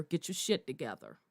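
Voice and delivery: hushed quick scolding; muttered order. Acted. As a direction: drop the voice and mutter the command quickly.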